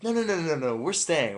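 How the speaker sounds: high, loud string of refusals; retold protest. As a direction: protest insistently, pitched well up.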